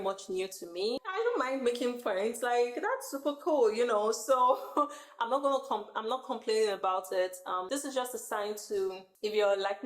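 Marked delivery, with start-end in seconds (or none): 4.6-5.1 short laugh. Real, not acted. Giggles about new friend.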